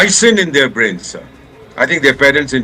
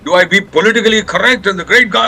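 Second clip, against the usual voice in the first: real anger; 7 semitones higher than usual. Real, not acted. Ambassador ranting on air, angry. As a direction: rail against it bitterly. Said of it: "I am angry today".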